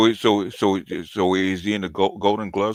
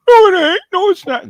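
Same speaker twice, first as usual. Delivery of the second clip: very high, loud squeal of denial; mock protest; 8 dB louder than usual. Man mimicking someone denying equity is feminist, shrill mock protest. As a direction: squeal it as a mocking impression of an objector.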